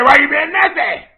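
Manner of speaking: loud, high, accusing mock yell; mock shout. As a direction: bark the question like picking a fight. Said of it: "You said".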